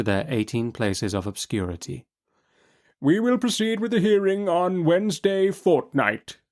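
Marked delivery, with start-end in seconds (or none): 3.0-6.4 high, measured judge voice; adjourn the case with dry calm; Chancellor's ruling. A narrator's reading. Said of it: "says the Chancellor".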